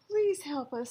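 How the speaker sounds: high, frightened plea; pleading. A narrator's reading. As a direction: beg for help. Begging in fear.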